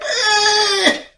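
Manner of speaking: sharp, high, loud eh; defiant exclamation. Retold or imitated. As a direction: stop the adult in her tracks. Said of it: "That girl looked at me like".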